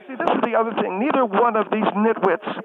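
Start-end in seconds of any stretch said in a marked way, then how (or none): none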